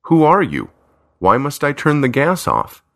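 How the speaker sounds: baffled, both questions lifted high; surprised. A narrator's reading. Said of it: "David says in surprise".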